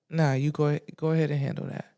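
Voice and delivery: low, soft, annoyed dismissal; dry, over it. Retold or imitated. Client dismissing therapist to handle her ex, dry and annoyed. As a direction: wave them off dryly, voice dropped. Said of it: "I was so dry and over it".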